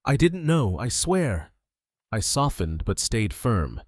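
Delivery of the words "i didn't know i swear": sobbing it out; voice breaking. A narrator's reading. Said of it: "her voice breaking".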